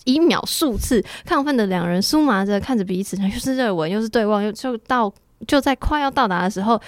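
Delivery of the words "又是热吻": ordinary talk, nothing marked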